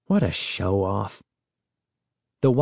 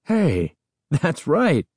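impressed exclamation; 6 semitones higher, much slower than usual. A narrator's reading. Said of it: "Uncle Ben exclaimed".

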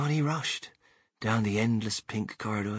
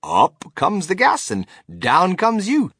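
louder, higher, lively Wonka voice; Mr Wonka, excited storyteller; 3 semitones higher, 7 dB louder than usual. A narrator's reading. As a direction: relish the warning as an eccentric inventor.

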